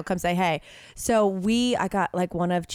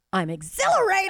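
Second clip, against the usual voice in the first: excited; 11 semitones higher than usual. Retold or imitated. Retelling leaving in elation.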